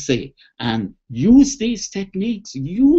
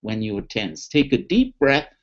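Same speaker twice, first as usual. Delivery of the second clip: guided relaxation; 7 semitones lower than usual. Shown as a demonstration. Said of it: "so here we go".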